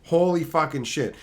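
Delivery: ordinary delivery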